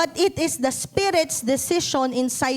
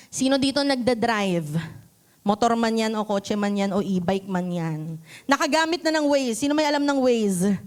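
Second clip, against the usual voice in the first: ordinary talk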